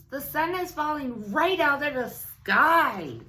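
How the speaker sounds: high, loud panicked goose cry; silly goose shrieking help. A narrator's reading. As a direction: squawk for help like a frightened goose. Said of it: "cried the goose".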